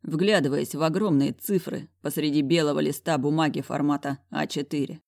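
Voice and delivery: ordinary delivery, nothing marked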